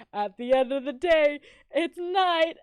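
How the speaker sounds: high and loud, cracking into laughter; shouted in-joke. Retold or imitated. Friends yelling their in-joke at the old lady. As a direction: yell the catchphrase at each other, half laughing. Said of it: "turned to each other and go".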